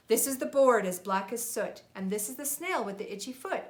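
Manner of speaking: put-on lively read-aloud for a child; storybook reading. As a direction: read it like a bedtime picture book. Parent reads picture book aloud.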